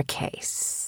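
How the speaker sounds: soft unvoiced whisper. Real, not acted. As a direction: whisper it as a secret aside. Aside whispered to listeners.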